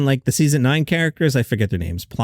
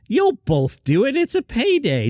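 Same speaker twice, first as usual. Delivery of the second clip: high, teasing I-know-better voice; knowing scoff; 12 semitones higher than usual. Retold or imitated. Host chiming in on the actresses, mocking. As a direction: needle them, pitched way up. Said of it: "I'll chime in".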